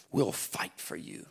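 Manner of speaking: low hushed whisper. Acted. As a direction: drop to a secret breath. Quoting verse in a hush.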